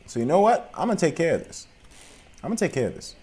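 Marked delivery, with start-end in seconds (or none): none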